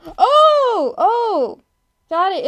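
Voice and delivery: high, loud drawn-out "oh"; sudden realization. Real, not acted. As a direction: burst out as it dawns on you. Sudden realization reading the chat.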